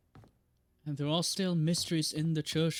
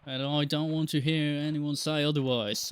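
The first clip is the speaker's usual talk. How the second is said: ordinary talk, nothing marked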